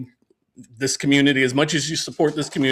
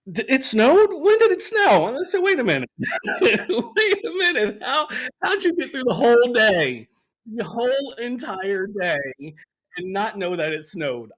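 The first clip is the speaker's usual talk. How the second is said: mock-surprised neighbor, pitched higher; imitating neighbor; 9 semitones higher than usual. Neighbor texting about snow, mock surprised and higher. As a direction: play the clueless neighbor asking, high and bewildered. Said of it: "She messaged me ... talking about".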